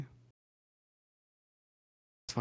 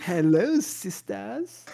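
high, camp influencer greeting; lilting mock vlogger hello, rising at the end; James Charles; 8 semitones higher, much slower than usual. Podcaster joining the beauty influencer spoof, high camp greeting. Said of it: "James Charles is Hitler?".

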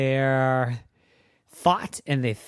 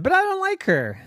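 whiny troll; 3 dB louder, faster than usual. Acted. Troll whines.

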